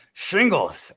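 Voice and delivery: half-sure Scottish movie-star impression; Sean Connery. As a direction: try out a famous Scottish actor's voice. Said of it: "now do Sean Connery in Highlander".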